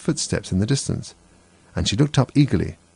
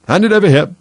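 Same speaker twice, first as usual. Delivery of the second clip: loud high demand; commanding; 10 semitones higher, 8 dB louder than usual. A narrator's reading. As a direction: bark the order out. Dodo demands thimble.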